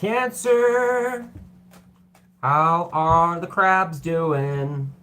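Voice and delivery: high, loud, drawn-out greeting; playful call-out. Real, not acted. Reader hails Cancer viewers, playful high call-out. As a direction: sing out the hello, stretching the words.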